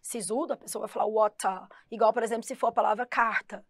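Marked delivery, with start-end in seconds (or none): 1.1-1.7 WATER with a clear T, ending "tah"; very British "waw-tah" for water; British pronunciation. Shown as a demonstration. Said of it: "Se falar um britânico bem".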